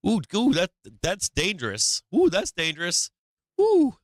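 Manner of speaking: fussy, squealing mock alarm, rising to a high loud ooh; mocking Reddit worrier. Mocking Reddit worriers, squealing in fake alarm. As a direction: ham up a nervous basement dweller clutching pearls. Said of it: "like one of the Reddit basement dwellers".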